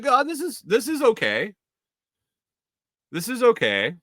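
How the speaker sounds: high, delighted little gasp of approval; mock amazed. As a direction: act pleasantly stunned. Pleased reaction to correct order.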